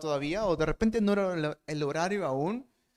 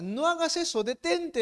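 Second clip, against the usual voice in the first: urgent plea; 8 semitones higher than usual. Acted. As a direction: cry out to stop him. Pablo stopping the jailer. (grito urgente para detenerlo)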